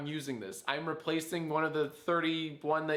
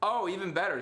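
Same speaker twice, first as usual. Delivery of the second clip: ordinary talk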